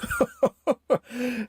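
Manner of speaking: big amused laugh; Gordon laughing. A narrator's reading. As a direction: laugh at Thomas's fright. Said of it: "Gordon laughed".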